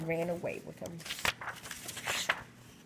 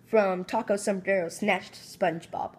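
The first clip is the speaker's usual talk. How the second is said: plain reading voice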